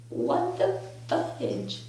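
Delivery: high, drawn-out exasperation; mock disbelief. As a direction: stretch each word out in high-pitched disbelief. Retold reaction to friend's meanness.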